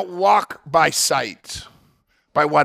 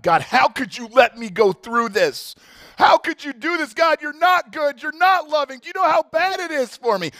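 retold anger at God; 11 semitones higher than usual. Preacher reliving the times he was mad at God, hurt and accusing. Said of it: "there's times where I've been mad at God".

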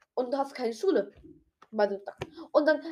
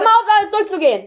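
annoyed protest; 10 semitones higher, 12 dB louder, faster than usual. Real, not acted. Friend rattling through the questions.

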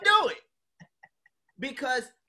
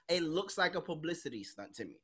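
ordinary talk, nothing marked

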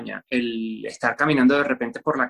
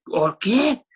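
imitating puzzled locals, pitched up; higher, incredulous question; baffled reaction; 6 semitones higher, 3 dB louder than usual. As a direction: act out someone who has no idea what was said. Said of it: "Y aquí la gente que".

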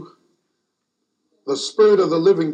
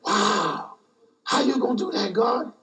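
amazed question; 5 dB quieter than usual. Retold or imitated. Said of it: "that was my response".

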